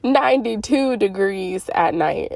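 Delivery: ordinary talk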